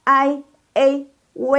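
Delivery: I, A, W as ai, ei, wei, high and level; model diphthongs. Shown as a demonstration. Teacher models the second group of sounds, high and sustained. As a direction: hold each glide on a high level pitch. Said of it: "kemudian yang kedua".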